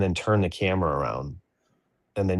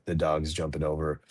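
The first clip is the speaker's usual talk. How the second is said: ordinary delivery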